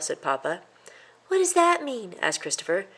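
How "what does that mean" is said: put-on much higher, questioning boyish voice; a little mouse asking his dad; curious young mouse. A narrator's reading. Boy asks.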